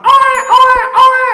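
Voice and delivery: very high, loud mock baby wail; baby's cry. Preacher mimicking a newborn crying for mother, shrill mock wail. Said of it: "modalku cuman oe".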